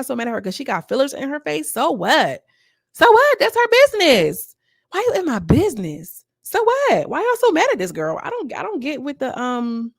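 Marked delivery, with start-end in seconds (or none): 2.9-6.2 So what? shot up much higher and louder; high, loud, fed-up squeal; exasperated outburst. Real, not acted. Repeats "so what" with rising heat. 6.5-8.2 exasperated outburst. Real, not acted. Heated defence of the cast member.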